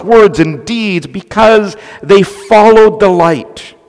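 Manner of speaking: slowing on the last words, full fervour; forceful preaching. Acted. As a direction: preach it hard to the congregation. Pastor builds to forceful exhortation.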